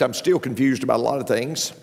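ordinary talk, nothing marked

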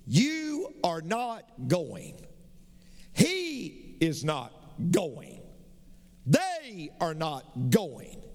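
"You are not going" spelled out very slowly, word by word; slow it right down, each word of "You are not going" carefully separate; slow correct grammar. Retold or imitated. Mother teaching her son, very slow "You are not going". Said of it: "You say this".

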